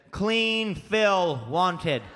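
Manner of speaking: very slow, deliberate reading; read out slowly. Shown as a demonstration. Reading a roadside sign aloud, very slow. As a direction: spell out the sign word by word.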